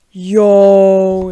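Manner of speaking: loud, drawn-out excited cry; excited exclamation. Real, not acted. Unboxer spotting the new mouse inside, loud excitement. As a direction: call it out big and long. Sees the item inside box.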